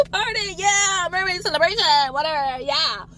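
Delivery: high, careless party voice; drunk partier. Retold or imitated. Drunk swimmers shrugging off the warning, careless. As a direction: brush it off like a tipsy reveler. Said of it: "So these people like".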